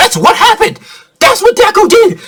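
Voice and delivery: gushing fan; 兴奋地尖声说; high excited. Real, not acted.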